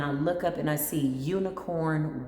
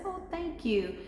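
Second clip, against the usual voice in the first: high, soft, touched thank you; high, soft, charmed thanks; delighted thanks; 12 semitones higher, 4 dB quieter than usual. Retold or imitated. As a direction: sound charmed and grateful. Her grateful reply.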